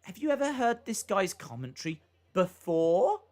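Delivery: BEFORE lifted into a question at the end; imitated question intonation. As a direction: mimic a text-to-speech question, rising on "before". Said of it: "you put a question mark".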